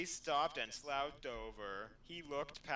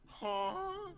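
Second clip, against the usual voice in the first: surprised; 3 dB louder than usual. Acted. Betty surprised in story.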